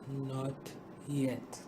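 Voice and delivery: low, soft and gentle; a calm, smiling reply, low and soft. A narrator's reading. Master answering the frightened grain, low gentle calm. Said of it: "he only smiled and gently said".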